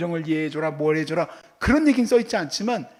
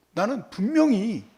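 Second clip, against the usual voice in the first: ordinary talk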